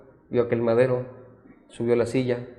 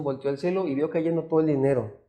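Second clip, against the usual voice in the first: usual speaking voice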